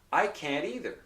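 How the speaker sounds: "can't either" linked by a quick tapped T; flapped T. Shown as a demonstration. Teacher showing another way to say it, "can't" with a flap. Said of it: "that is called a flap or a tap".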